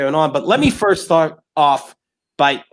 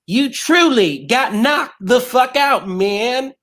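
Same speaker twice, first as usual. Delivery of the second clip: lightly voiced Chris Tucker, pitched up and punchy; 7 semitones higher, slower than usual. Host pays tribute to Tiny Lister, lightly voiced Chris Tucker impression. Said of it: "That was your Chris Tucker impression?".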